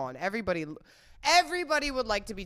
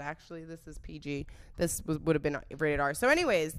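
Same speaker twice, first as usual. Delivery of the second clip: normal talking voice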